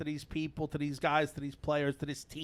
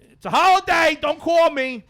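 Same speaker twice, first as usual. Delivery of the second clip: exasperated outburst; 9 semitones higher, 9 dB louder than usual. Real, not acted. Annoyed at work call on holiday.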